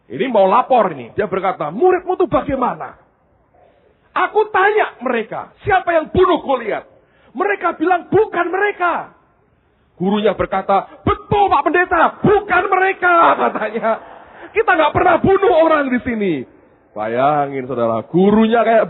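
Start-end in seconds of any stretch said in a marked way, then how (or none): 1.7-9.1 exasperated pastor. Retold or imitated. Pastor angrily reporting the students to their teacher. 11.0-16.3 high, loud earnest teacher voice; naive teacher. Retold or imitated. Teacher innocently defending students to the pastor, high and loud.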